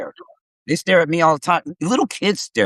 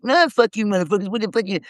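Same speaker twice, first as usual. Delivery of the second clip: higher, defiant yelled insults; talking shit back; 10 semitones higher, faster than usual. Retold or imitated. Cussing out guys chasing him, raised defiant yell. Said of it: "I'm talking shit".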